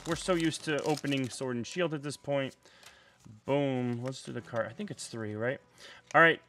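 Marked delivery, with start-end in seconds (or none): none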